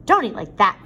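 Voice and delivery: sharp, loud father's rebuke; father snapping at his son at supper, loud; snapped. A narrator's reading. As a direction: snap at him across the table. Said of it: "Father snapped".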